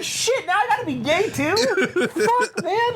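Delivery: very high, laughing wail; mock anguish. Kid reacting to his friends' verdict, hammed-up anguish.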